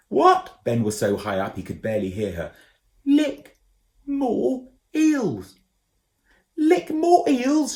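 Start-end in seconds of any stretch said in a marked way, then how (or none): 0.1-0.6 high, loud puzzled call; shouted what. A narrator's reading. Ben can't hear. 3.0-5.6 high, loud call from below; shouting from far. A narrator's reading. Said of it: "shouting up at him". 6.6-7.8 high, slow, loud puzzled shout; baffled shout. A narrator's reading. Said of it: "shouted Ben".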